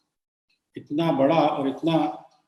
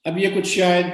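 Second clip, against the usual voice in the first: normal talking voice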